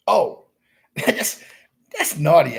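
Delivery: loud laughing scold. Real, not acted. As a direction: burst out laughing at the tease. [name] teasing him off camera.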